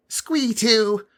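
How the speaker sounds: very high squeaky goblin; goblin squeal. A narrator's reading. Squee reports through speaking tube, squealing. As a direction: squeal it eagerly. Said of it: "came a squeal through the speaking tube".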